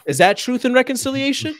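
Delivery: high, loud incredulous question. Retold or imitated. Repeating the question to the group, high and incredulous. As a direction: pitch it way up in disbelief.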